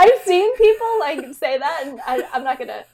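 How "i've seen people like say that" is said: high, slow, laughing through the words. Real, not acted. Caught talking about herself in third person, laughing. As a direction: giggle out an excuse.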